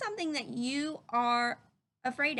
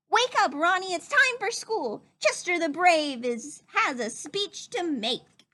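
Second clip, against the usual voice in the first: high, loud wake-up call to his brother; excited shout; 5 semitones higher, 5 dB louder than usual. A narrator's reading. Chester waking his sleeping brother Ronnie with a high, loud shout. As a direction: bounce in yelling him awake.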